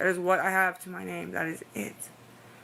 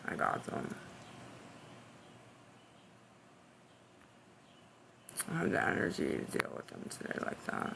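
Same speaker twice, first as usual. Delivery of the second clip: low, soft, breathy mutter, much softer; whispered aside; 14 semitones lower, 12 dB quieter, faster than usual. Real, not acted. Streamer muttering to herself about trolls, whispered. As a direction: say it under your breath, worn out.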